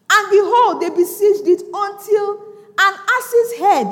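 loud, high, drawn-out scripture reading; preach at full force. Real, not acted. Preacher proclaims the siege to church, loud slow declamation. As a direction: proclaim the verse, stretching the words.

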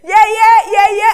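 put-on high, loud baby-talk call of yeah yeah; baby babble. Little brother calling the house help, high baby babble. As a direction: mimic a little brother's baby name for someone. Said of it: "calling the house help, yeah yeah".

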